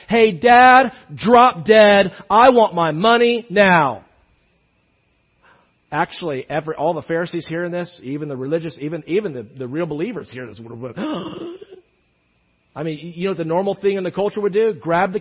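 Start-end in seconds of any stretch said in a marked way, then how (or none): 0.1-4.0 loud, brash demand at Dad; demanding son. Acted. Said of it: "the kid's saying to his dad". 10.6-11.7 sharp acted gasp of horror; shocked gasp. Acts believers' shock at this.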